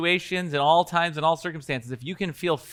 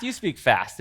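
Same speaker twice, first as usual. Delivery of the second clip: usual speaking voice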